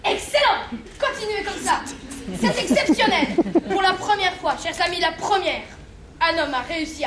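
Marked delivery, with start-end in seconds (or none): 0.0-3.3 loud, high, thrilled cheer; excited cheering. Acted. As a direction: cheer him on like a TV host. Anchor cheering on reporter. (acclamation enthousiaste)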